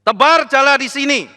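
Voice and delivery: high, loud command; mimicking the master telling the fisherman where to cast; commanding order. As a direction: bark the instruction. Jesus ordering Peter, retold.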